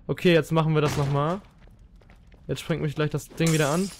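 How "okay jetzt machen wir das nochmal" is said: usual speaking voice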